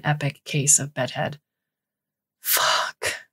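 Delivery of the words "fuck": whispered repeat. Acted. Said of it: "Cass repeats".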